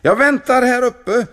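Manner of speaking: high, loud call from up top; a bright character voice calling down; cheerful call. Acted. Kulan calling down to the monk from the tower.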